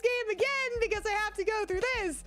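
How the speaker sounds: high, hammed-up wailing complaint; mock wail. Player recalling a first playthrough, mock wail. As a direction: wail it high from start to end. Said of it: "and I'm just like".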